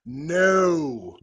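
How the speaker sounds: a big, loud, final no; emphatic no. Acted. Refusing a viewer's question, a loud emphatic no. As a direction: slam the door shut with one word. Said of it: "say with an emphatic".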